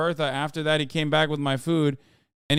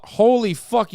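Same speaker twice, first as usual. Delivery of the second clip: higher, louder burst of disbelief; real surprise, pitched up; amazed outburst; 7 semitones higher, 5 dB louder, slower than usual. Real, not acted. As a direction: blurt it out, astonished. Reacting to how much people wrote.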